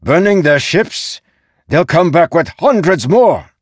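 high, loud outraged shouting; angry general calling out; called from behind. A narrator's reading. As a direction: shout the alarm at a reckless subordinate. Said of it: "the general called from behind".

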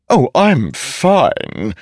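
high, slow, cheeky talking sword; a living sword, drawn-out and sly; ringing metallic sword voice. A narrator's reading. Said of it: "said the sword strapped to Szeth's back".

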